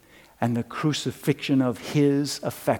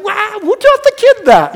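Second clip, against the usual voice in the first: mock amazement; 15 dB louder than usual. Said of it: "I'm like".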